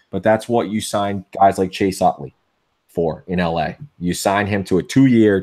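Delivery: ordinary talk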